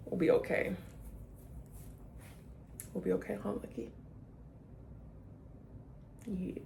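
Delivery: barely voiced, tender reassurance; soothing the dog. Real, not acted. Whispering to her dog Lucky that they'll be okay. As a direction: hush and comfort, mostly to yourself.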